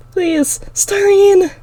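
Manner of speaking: high, slow, strained breathy cry; desperate plea. A narrator's reading. Terrified girl begging for her sister, breaking cry. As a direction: beg for her in terror. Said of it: "I yell".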